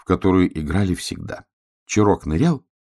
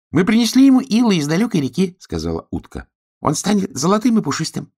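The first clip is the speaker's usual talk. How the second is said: little duck voice; 11 semitones higher, 4 dB louder than usual. Acted.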